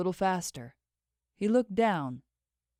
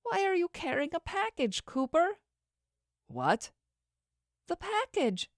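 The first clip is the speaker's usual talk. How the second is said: tearful; 11 semitones higher than usual. Acted. Tearful, suspicious questioning.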